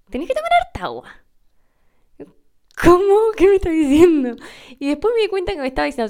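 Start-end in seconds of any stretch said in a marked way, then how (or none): 0.1-1.0 high, baffled repeat of a strange phrase; confused echo. Retold or imitated. 2.8-4.4 high, loud, bewildered question; baffled outburst. Retold or imitated. Not understanding the husband.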